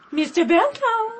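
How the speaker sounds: firmer call. Acted. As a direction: call out brightly for an answer. Second, firmer call into room.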